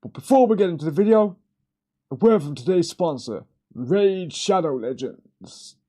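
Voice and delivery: high, loud, fast sponsor pitch; mock sponsor read. Parodying a YouTuber's sponsor plug, high and loud. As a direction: ham up a YouTube ad segue.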